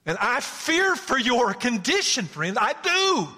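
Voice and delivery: high, loud, slow theatrical lament; dramatic character voice. Acted. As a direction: cry out the doubt like a stage actor.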